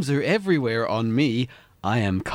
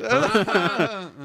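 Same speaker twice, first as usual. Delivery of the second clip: high laugh; 8 semitones higher than usual. Real, not acted. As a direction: burst out laughing. Laughing at the joke.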